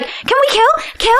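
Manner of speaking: eager high sword voice, begging to kill; a golden retriever of murder; ringing metallic sword voice. Retold or imitated. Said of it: "He's like".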